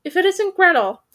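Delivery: a knowing high raven. Acted. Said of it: "said the first raven".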